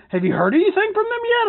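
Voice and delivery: high, fast mock scammer question. Scammer's text read in a high mocking voice. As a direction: squeak it out like a pushy scammer.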